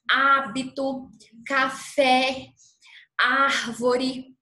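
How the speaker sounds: "hábito", "café", "árvore" with wide-open, loud stressed vowels. Shown as a demonstration. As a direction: open the mouth on the Á and É. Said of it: "um som que é aberto".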